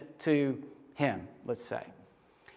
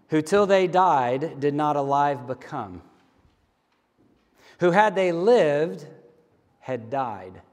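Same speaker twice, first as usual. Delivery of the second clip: slow, weighty poetry recital; reading Dickinson aloud to the congregation, slow and grave; poem reading; 4 semitones lower, slower than usual. Acted. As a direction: let each line land with long pauses. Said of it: "Emily Dickinson puts it this way".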